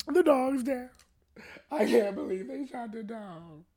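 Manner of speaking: playing mom in disbelief; shocked mom whimpering the news; stunned grief. Mom in shock after dog shot.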